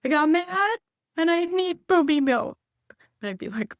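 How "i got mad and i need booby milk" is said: put-on high, loud toddler voice; put-on tiny-child whine; imitating little kid. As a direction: declare it like an upset toddler making a demand. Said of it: "as a little, little kid, he would say".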